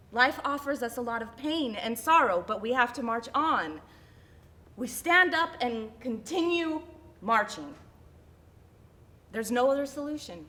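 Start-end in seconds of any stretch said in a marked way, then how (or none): none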